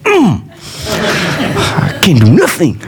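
put-on high, whining childish "Mm, I can't do nothing"; whiny kid voice. Son imitating himself after mom says ask dad: high whine. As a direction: play the boy sent from mom to dad, sulking. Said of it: "I'd be like".

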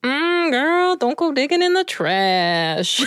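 sass it like a street-smart raccoon; sassy raccoon. Pretend. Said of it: "now be, like, a sassy raccoon".

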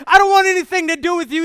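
retold teenage defiance; defiant reply. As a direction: spit it back at the father.

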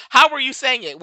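laughing, incredulous question; amused challenge. Real, not acted. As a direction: ask it loudly through real amusement. Laughs while challenging a commenter.

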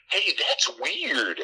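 high, slow, dopey put-on voice; mock puzzled character. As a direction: draw it out high, mocking the bystander. Voices oddly unaffected movie character.

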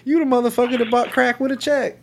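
high, put-on voice of a wiry little crackhead; imitating a squeaky crackhead, amused; voiced crackhead. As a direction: mimic a high-pitched jittery crackhead recognizing someone. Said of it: "they're like".

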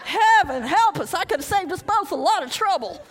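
loud laughing heaven help us; laughing exasperation. Real, not acted. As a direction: laugh at your own folly.

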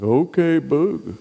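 put-on slow, drawn-out old guy's drawl; slow-talking old pastor. Old pastor answers an urgent phone call, slow and unhurried. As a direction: stretch the words out, in no hurry at all. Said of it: "That's how he talks".

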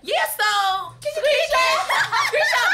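excited laughing. Retold or imitated.

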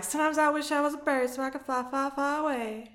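light movie impression; quoting Jenny from Forrest Gump. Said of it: "start quoting Forrest Gump".

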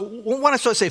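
ordinary talk, nothing marked

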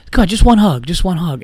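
high loud pleading whine; whiny begging drunk. Retold or imitated. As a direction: beg like a sloppy drunk.

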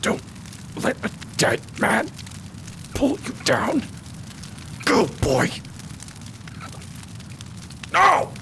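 high, strained last words of a dying man; dying mentor's plea. Acted. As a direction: beg the boy to leave you behind. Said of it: "he goes".

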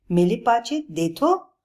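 proud lion showing his book, lisping. Acted. Lion shows Bablu his picture book, lisping.

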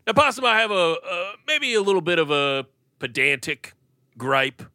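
high, loud prissy voice; pompous critic. Acted. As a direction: play the fussy know-it-all.

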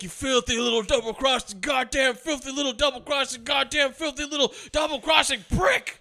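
high, relentless mock tirade; hammed-up raging imitation; Cage in meltdown. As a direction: loop the insult in a high frenzy. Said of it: "full fucking meltdown Cage".

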